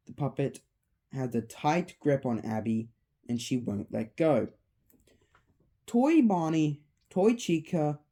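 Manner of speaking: usual speaking voice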